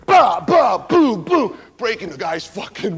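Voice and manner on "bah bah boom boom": loud, high mock punch noises; punch sounds. Frankie beating the driver, loud mock punch noises. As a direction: beat out the blows with your voice.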